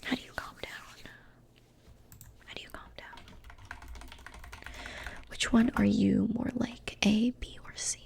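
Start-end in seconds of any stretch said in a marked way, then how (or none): 0.0-3.2 distant echoed whisper; far-off layered whisper; echoing whisper. Acted. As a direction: let the question drift back as a faint echo. Faint echoed question.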